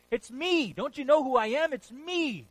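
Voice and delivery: It's me thrown out full force; proclaiming shout. Acted. Preacher crying out Jesus' words to the congregation, loud and high. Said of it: "preacher proclaims with force".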